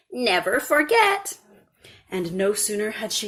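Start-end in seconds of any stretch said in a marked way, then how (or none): 0.1-1.4 slow, higher, earnest reminder; old woman's warning. A narrator's reading. Old woman warning the girl before vanishing, slow and earnest. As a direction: press it on her like a wise crone. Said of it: "said the old woman".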